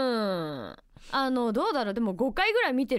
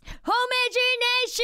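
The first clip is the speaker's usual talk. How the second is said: title call; 10 semitones higher, 3 dB louder, slower than usual. Acted. Calling the corner's title.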